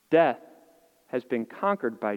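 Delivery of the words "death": normal talking voice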